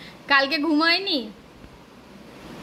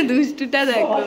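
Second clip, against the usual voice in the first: laughing fondly; 6 semitones lower than usual. Real, not acted. Laughing at the happy toddler.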